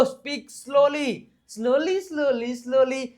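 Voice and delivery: drawn-out, deliberately slow demo; speaking slowly. Shown as a demonstration. Teacher showing students how to speak slowly. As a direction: stretch every word out, very slowly. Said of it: "ધીમે બોલો".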